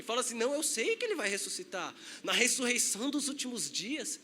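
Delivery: ordinary talk, nothing marked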